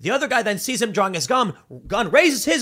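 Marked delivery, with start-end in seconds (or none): none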